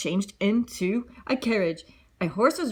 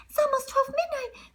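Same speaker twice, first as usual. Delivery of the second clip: put-on anxious girl. Warning about midnight.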